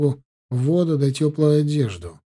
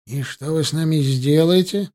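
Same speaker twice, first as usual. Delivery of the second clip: ordinary delivery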